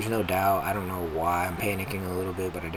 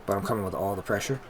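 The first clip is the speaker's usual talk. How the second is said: ordinary talk, nothing marked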